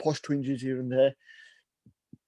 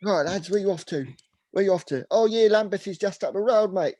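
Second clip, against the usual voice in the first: higher-pitched Cockney cabbie; Cockney accent; 5 semitones higher than usual. Retold or imitated. London cabbie directing lads up the road, Cockney accent. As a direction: imitate a chatty Cockney driver. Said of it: "proper, like, Cockney accent".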